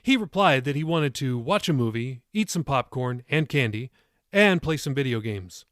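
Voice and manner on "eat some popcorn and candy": usual speaking voice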